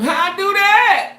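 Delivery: squeaky, high and loud disbelief; high, loud and slow mock despair; inner voice. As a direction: ask it in a shrill put-on helpless voice. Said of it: "I'm thinking to myself".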